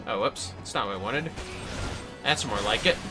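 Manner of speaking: usual speaking voice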